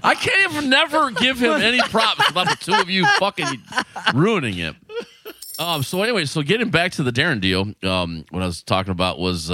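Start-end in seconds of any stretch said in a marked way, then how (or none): 0.0-4.8 high-pitched, laughing exasperation; cracking up while protesting; laughing complaint. Real, not acted. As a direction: gripe at your friends through helpless laughter. Mock complaint at co-hosts through laughter.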